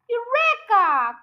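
high, loud, drawn-out shout; father realising the missing ingredient; triumphant cry. A narrator's reading. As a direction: cry it out like a discovery. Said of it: "cried Mister Cranky".

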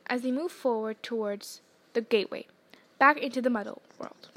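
ordinary delivery, nothing marked

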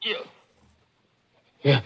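broken words with long pauses; dying gasps. Acted. Pawnbroker dying before his killer, gasping word by word. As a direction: force out each word with a last breath. Dying after being shot.

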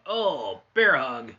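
sudden loud burst on bear hug; excited. Acted. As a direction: blurt it out, thrilled. Big move in commentary.

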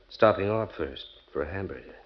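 ordinary delivery

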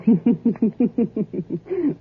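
tickled little laugh; sly high laugh. Acted. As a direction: laugh at your own clever thought. Wife laughing at her own idea.

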